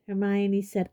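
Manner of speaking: ordinary delivery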